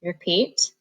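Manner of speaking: ordinary talk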